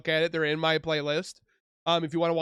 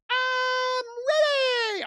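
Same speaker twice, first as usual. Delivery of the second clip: squeaky, much higher cartoon sponge cheer; SpongeBob voice; slower than usual. Reacting to the host, quoting SpongeBob in eager cartoon squeal. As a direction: chirp it like an overeager cartoon sponge. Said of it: "As SpongeBob says".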